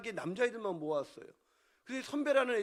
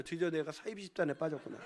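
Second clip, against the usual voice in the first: ordinary talk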